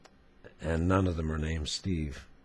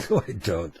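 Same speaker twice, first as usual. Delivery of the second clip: normal talking voice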